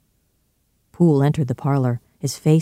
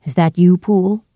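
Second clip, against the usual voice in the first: higher, louder wary question; lightly voiced woman at the door; woman's voice; 4 semitones higher, 5 dB louder than usual. A narrator's reading. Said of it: "A woman's voice asked".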